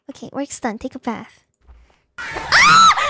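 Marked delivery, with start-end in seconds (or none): 2.2-3.1 very high, loud scream; two piercing high screams of fright; startled scream. Real, not acted. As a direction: shriek as if jump-scared. Startled scream in horror game.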